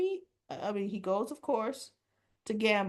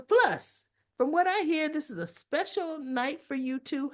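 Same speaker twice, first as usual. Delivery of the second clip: sly excited; 8 semitones higher, 4 dB louder than usual. Retold or imitated. Joey teasing the couple.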